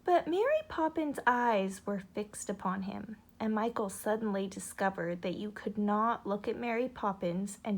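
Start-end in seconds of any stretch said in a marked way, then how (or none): none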